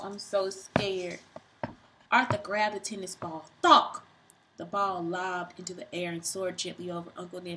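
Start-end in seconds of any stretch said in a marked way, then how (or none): 3.6-4.0 sharp high thunk; thunk sound. Acted. Reader voicing the racket hit, sharp thunk.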